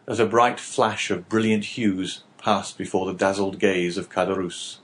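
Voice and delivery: usual speaking voice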